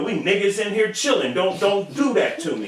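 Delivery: high whiny plea; Crunchy's offended voice. Retold or imitated. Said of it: "Here we go".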